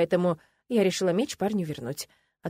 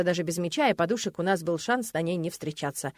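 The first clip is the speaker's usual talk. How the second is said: plain reading voice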